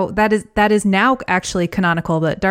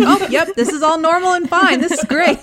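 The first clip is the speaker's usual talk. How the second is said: high, chirpy mock cheer; oblivious cheer; 9 semitones higher than usual. Muppets shrugging off the evil frog, high chirpy mock cheer. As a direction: chirp it out, pretending all is fine. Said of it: "And they're like".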